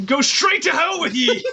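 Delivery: high, slow hammed-up shout; Krampus shout. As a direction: bellow it like a mock demon. Krampus voiced damning the listener.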